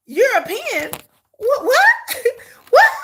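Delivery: high-pitched, disbelieving mock reaction; incredulous. Reacting to claims the accents sound European, incredulous. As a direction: repeat it in amused disbelief, voice jumping up.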